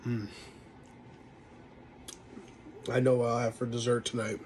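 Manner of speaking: spoon in mouth; mumbled through food; talking while chewing. Real, not acted. Seen 0: Spoon of dip goes into mouth mid-words; closed-mouth chewing follows.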